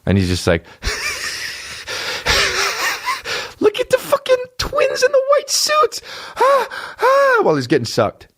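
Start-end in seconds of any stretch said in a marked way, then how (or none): 0.8-3.5 squeaky breathless laugh; high wheezy giggle. Retold or imitated. Friend mocked watching Matrix, giggling high. 3.6-7.4 much higher, giddy excited shout; excited high squeal. Retold or imitated. Mocking friend watching Matrix, high excited squeal.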